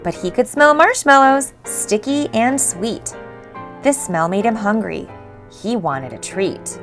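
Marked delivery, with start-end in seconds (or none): none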